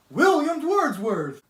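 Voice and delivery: high, loud and slow, like a showman announcing a star; grand introduction. Acted. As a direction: boom the name out to the audience. Host grandly introduces the guest.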